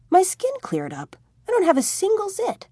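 put-on young man thrilled his pimples are gone; delighted discovery. A narrator's reading. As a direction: squeal with teenage joy at the mirror. Tommy delighted at clear skin.

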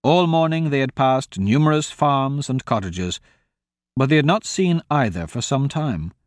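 usual speaking voice

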